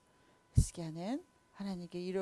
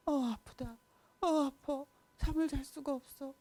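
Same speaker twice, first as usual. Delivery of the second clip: pained king; 6 semitones higher than usual. Acted. Sick king groans in pain.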